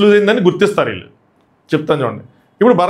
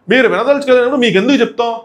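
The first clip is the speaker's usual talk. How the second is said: మీరు వినదలుచుకోలేనప్పుడు మీకెందుకు చెప్తాం said higher, a mild retold raised voice; a bit louder; 6 semitones higher than usual. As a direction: imitate him raising his voice a little. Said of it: "ఇక్కడ కొంచెం గట్టిగా మాట్లాడు". (కొంచెం గట్టిగా)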